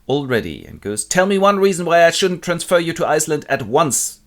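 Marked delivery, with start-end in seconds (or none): 1.0-4.2 raised, louder boss voice; impatient demand. Acted. Director threatens Bernard with transfer to Iceland, impatient. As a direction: demand an answer from a subordinate, impatiently. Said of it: "the director is impatiently waiting for Bernard".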